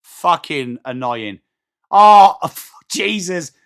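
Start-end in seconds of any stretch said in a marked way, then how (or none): 1.9-3.5 loud laugh through the words, slow; real laughing groan; laughing disbelief. Real, not acted. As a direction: laugh out the exclamation. Reacts to a blunder.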